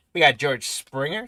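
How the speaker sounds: normal talking voice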